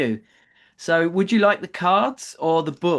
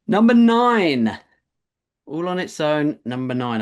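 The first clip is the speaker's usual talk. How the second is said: bingo caller; 5 dB louder than usual. Acted. Teacher calling bingo numbers.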